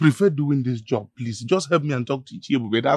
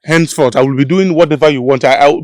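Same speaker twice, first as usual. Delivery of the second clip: desperate loud pleading; 2 semitones higher, 10 dB louder than usual. Acted. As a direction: plead like your job hangs on it. Desperate plea to keep job.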